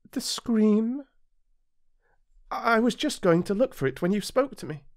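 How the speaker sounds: high, coy flower covering a fib; lightly voiced proud flower caught in a lie; sweet flower voice. A narrator's reading. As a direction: bluff it out sweetly. Flower voice held.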